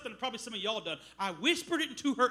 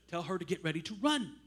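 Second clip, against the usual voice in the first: voice of the Spirit; 7 semitones lower, 3 dB quieter than usual. Retold or imitated. Retells the Holy Spirit speaking.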